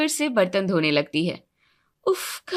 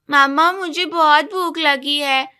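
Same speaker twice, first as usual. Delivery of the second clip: put-on slow, higher whiny little boy; small child voice; 4 semitones higher, 4 dB louder than usual. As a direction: play a hungry little boy tugging at mom. Little son asks mother for food. (भूखे छोटे बच्चे की ऊँची आवाज़)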